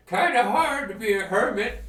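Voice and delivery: put-on high, loud old hermit, American accent; cranky American hermit grumbling. Hermit complaining to visitors, loud American accent. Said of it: "I don't know why I'm American".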